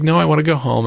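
put-on a touch of a child's complaint; upset child. Daughter refusing to stay, mildly upset. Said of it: "real upset and screaming".

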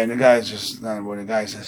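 usual speaking voice

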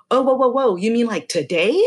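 back-pedal, startled it's so soon; caught off guard. Retold or imitated. Client startled the masseuse can come today, a high startled double-take.

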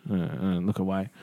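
soft, low mumbled no, looking away; quiet averted refusal. Retold or imitated. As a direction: brush them off without looking. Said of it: "don't make eye contact".

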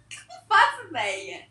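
words squeezed out through laughter; laughing. Real, not acted. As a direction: say it while still cracking up. Still laughing after the outburst.

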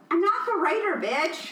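acted-out righteous scolding; indignant clapback. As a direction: tell off the trolls, voice lifted. Retelling her clapback to trolls.

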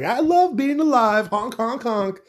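high, slow, sing-song happy duckling voice; squeaky, delighted cartoon duckling. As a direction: chirp it out like a joyful little duck. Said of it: "He's like".

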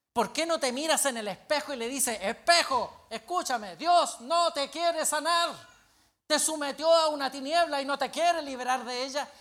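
loud, high, hammering rebuke; preacher's rising harangue at a friend; forceful confrontation. Retold or imitated. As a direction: drive each question home slowly and loudly. Acted harangue at the sick pastor.

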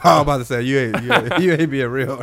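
laughing through words; cracking up mid-sentence. Real, not acted. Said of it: "Can't even say that with a straight face".

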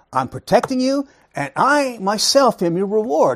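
high, loud, emphatic voice of God; God's proclamation. Acted. Preacher voicing God's promise to Abraham, emphatic proclamation.

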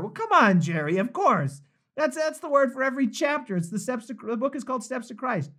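put-on playful high-pitched Southern accent. Host teasing Jerry about the book's title, Southern accent. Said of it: "somebody liked the Southern accent".

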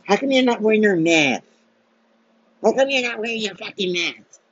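high, whiny mask scold; mocking nag voice. Vlogger imitating people who scold him about masks, high whine. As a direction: mimic the scold, pitched well up. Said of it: "people out there like".

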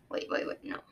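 plain reading voice

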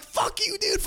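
very high mock yell, held back in volume; retold yelling. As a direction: re-enact yelling back at the driver. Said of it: "I'm yelling at my fucking Uber driver".

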